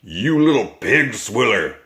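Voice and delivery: roaring insult. A narrator's reading. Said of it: "roared the blood bottler".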